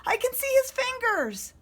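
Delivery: high loud alarm; excited call. A narrator's reading. Monster tells mommy he sees fingers, high loud. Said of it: "he called".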